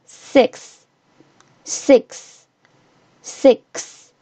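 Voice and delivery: six with short i and crisp final ks; short I vowel. Shown as a demonstration. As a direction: say six as spaced, short model words. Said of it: "发对应的短元音".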